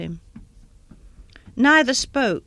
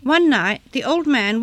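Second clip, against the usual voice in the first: ordinary delivery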